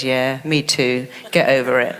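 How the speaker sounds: low, dry dismissive reply; the mind brushing you off; lightly voiced sarcastic inner voice. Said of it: "it says".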